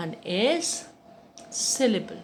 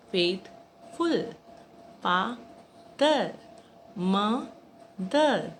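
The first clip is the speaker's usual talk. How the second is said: "Faithful, father, mother" each split into two slow beats; syllable by syllable; much slower than usual. Shown as a demonstration. Teacher showing children two-syllable words, syllable by syllable. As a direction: break it as "Faith-ful, fa-ther, mo-ther". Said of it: "These all are two syllable".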